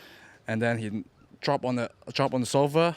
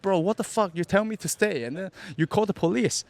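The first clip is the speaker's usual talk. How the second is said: higher, indignant retold complaint; retold outrage; 7 semitones higher, 3 dB louder than usual. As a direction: complain like you were betrayed, half-acted. Retold outrage at being arrested.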